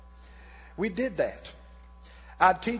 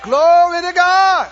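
exultant shout; 7 semitones higher, 13 dB louder, slower than usual. Real, not acted. Preacher's exultant praise shout.

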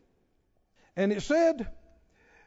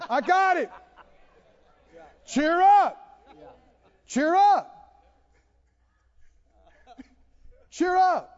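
ringing proclamation; 8 semitones higher, 6 dB louder, slower than usual. Acted. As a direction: cheer up belted out high and loud. Preacher proclaiming Paul's message.

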